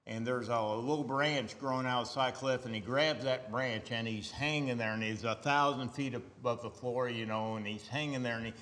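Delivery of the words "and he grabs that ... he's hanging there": ordinary talk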